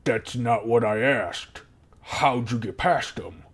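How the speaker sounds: slow, insistent goblin voice; pressing goblin guard. Acted. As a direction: press the boaster for a real answer.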